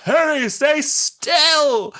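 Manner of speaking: slow, high, loud warning; mock commanding shout. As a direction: draw out an urgent order. Acting Doctor's slow warning.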